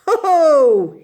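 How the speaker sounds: high, loud triumphant ho ho; gleeful crowing laugh. A narrator's reading. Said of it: "he cried".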